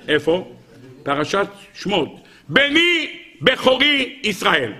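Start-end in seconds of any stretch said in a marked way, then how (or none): 2.5-4.7 very slow, high declamation; solemn proclamation. Acted. Teacher proclaims God's seal on the firstborn, slow and solemn. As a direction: proclaim each word, long and high.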